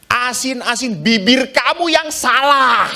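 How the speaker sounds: mock wife blaming his lips; defensive wife. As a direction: snap back like an offended wife. Wife retorts to husband.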